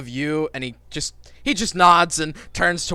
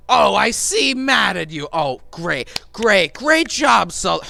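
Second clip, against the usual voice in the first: loud, higher sarcastic snap; bitter mock praise; sarcastic anger; 5 semitones higher, 5 dB louder, slower than usual. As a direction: boss sneers at Sullivan. Sarcastic boss reply.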